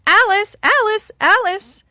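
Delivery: high, loud call of a name; sudden calling out. A narrator's reading. Old woman imitates a mother wanting help, calling loudly. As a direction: call a child as a mother would. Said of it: "Suddenly she called".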